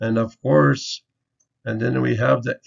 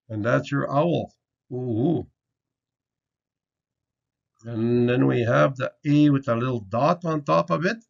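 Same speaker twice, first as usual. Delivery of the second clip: ordinary delivery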